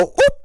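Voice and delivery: very high, loud sudden "Oop!"; imitated surprised yelp; mock yelp. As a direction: squeak it out as the player slips. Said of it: "and then go".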